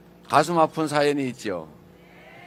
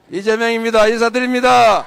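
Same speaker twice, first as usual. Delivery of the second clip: shouting; 6 semitones higher, 7 dB louder than usual. Real, not acted. Candidate greets rally crowd.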